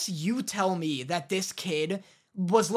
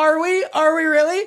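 high, loud mock excitement; hammed-up thrilled disbelief; giddy diner; 6 semitones higher, 8 dB louder than usual. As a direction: squeal it like a diner daring to indulge. Acting out giddy diners ordering fries.

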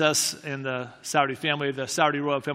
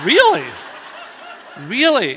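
loud, high-pitched mock disbelief; incredulous reaction; 6 semitones higher, 6 dB louder, slower than usual. Journalist hearing they followed Jesus here, loudly incredulous. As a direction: burst out high and loud, imitating her astonishment. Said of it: "she actually went like this".

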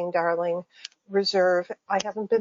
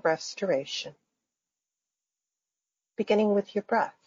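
guided meditation. Acted. Faculty member guiding listeners through breathing and imagery, calm meditation voice. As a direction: lead listeners gently into imagery, phrase by phrase.

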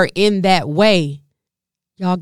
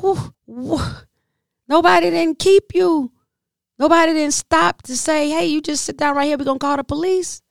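mock disbelief; 8 semitones higher than usual.

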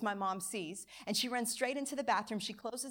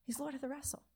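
softer, higher, hushed repeat; hushed reverent echo; 5 semitones higher, 8 dB quieter, faster than usual. Real, not acted. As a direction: let the repeat sink into a reverent hush. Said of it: "repeated softer".

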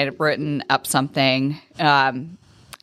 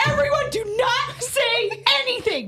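much higher, tense hushed urgency; panicked instructions. Retold or imitated. She warns the barn gals not to mention him. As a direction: urgently hush everyone as if in a crisis. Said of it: "And I was like".

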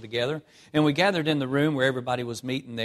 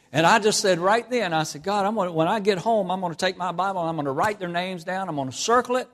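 higher and louder, building preacher's fervour; fervent vow; 6 semitones higher, 5 dB louder than usual. Retold or imitated. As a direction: proclaim the promise to God with rising fire. Preacher retells his vow to God.

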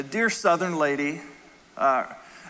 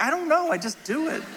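high-pitched, playful protest; mock defensive; 12 semitones higher than usual. Husband excusing his accent to his wife, mock defensive. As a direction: plead innocence in a higher voice.

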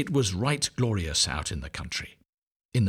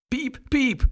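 high hatchling peep; ugly duckling's peep. A narrator's reading. Big ugly duckling hatching, high peep. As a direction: squeak like a newborn chick. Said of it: "said the little one".

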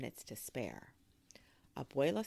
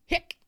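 sharp, loud "hic"; short high hiccup burst; 12 dB louder than usual. Acted. Narrator sounding out a noise in a children's story, sharp hiccup.

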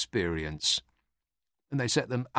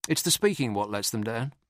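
ordinary delivery

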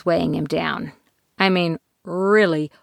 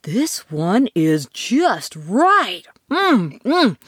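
satisfied; 2 semitones higher, much slower than usual. Acted. Savouring perfect porridge.

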